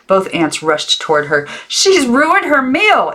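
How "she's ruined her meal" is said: very high, loud slow dismay; cried out aunt. A narrator's reading. Aunt Harriet dismayed at Maggie, very high and loud. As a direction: wail over the ruined meal. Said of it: "Aunt Harriet cried".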